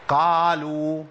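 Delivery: قالوا drawn out with a fully open, thinned qaf; wide "qaa-lu", a faulty qaf; over-open qaf. Shown as a demonstration. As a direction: show the mistake: قالوا with too wide an "aa". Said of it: "دي مش قاف،".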